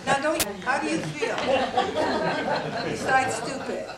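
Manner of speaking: laughing at the silly exercise; laughing tease. Real, not acted. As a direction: tease them through a laugh. Teasing audience after exercise.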